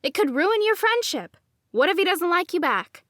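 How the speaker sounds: high, loud fretful warning; arguing inner voice. A narrator's reading. Inner voice argues with narrator, high and anxious. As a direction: plead like a worried conscience. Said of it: "the dominant voice argued".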